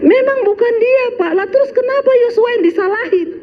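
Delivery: high voice through laughter; laughing teacher. Retold or imitated. Teacher laughs at the father's defence, high and giggling. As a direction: giggle out the answer. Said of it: "Gurunya makin tertawa lagi".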